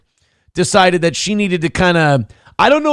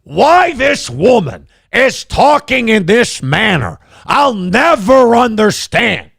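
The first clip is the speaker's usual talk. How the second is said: slow, higher, put-on comic voice; Godfrey impression; 4 semitones higher, 4 dB louder, slower than usual. Shown as a demonstration. Host shows how he does Godfrey, slow put-on voice. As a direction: drag it out in a squeaky mock drawl. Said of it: "I have to go".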